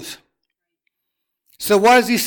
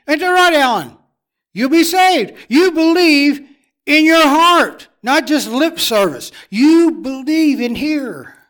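rising, louder preacher's call; fervent preaching; 5 semitones higher, 5 dB louder, slower than usual. Acted. Preacher pressing the church to believe, louder and fervent. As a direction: drive it home to the congregation.

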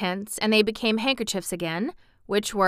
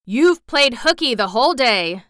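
raised, louder voice of reproach; reproachful; 5 semitones higher, 7 dB louder than usual. A narrator's reading. Shaggy Man to the returning girl, reproachful. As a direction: chide the runaway, louder and higher. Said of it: "added the Shaggy Man reproachfully".

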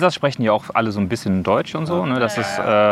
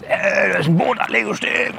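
scolding; 8 semitones higher than usual. Retold or imitated. Parents scolding rowers on jetty.

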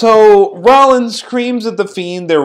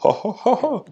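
softer, mock maniacal laugh; imitated maniacal laughter; 6 semitones higher, 7 dB quieter than usual. Podcaster imitating laughter between crowd boos, mock maniacal. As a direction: put on a crazed villain's chuckle. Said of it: "the maniacal laughter".

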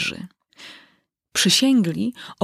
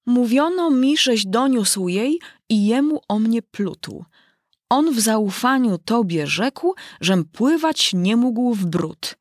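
slow, sing-song recitation of a poem; reciting verse; 5 semitones higher, slower than usual. Acted. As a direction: declaim the rhymes line by line. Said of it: "recytował następujące wiersze".

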